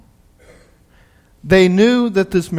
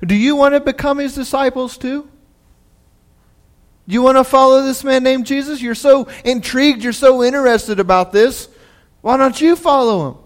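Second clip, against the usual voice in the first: higher, mocking questions thrown back; sarcastic retort; 7 semitones higher, 3 dB louder than usual. Healed man mocking the Pharisees, sarcastic and high. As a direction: needle them with sarcasm. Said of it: "he gets a little sarcastic".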